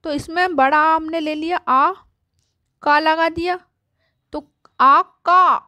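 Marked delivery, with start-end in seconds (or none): 4.3-5.6 slow, drawn-out आ का with the long vowel held; stretched long aa. Shown as a demonstration. Teacher showing kids the long आ in का. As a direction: stretch the आ in का and lean on it. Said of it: "का बोलने में जोर दे रहा है".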